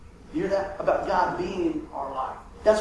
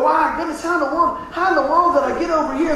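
higher, louder mock-bewildered cry; 9 semitones higher, 6 dB louder than usual. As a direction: act lost and amazed. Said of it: "we go".

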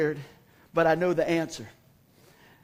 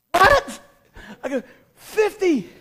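high shout; 9 semitones higher, 8 dB louder, slower than usual. Retold or imitated. Manager demanding, boy answering.

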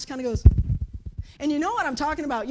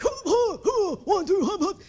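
higher louder cadence call; quarterback cadence; 6 semitones higher, 5 dB louder than usual. Pretend. Quarterback calling the play, louder higher cadence. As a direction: bark the snap count.

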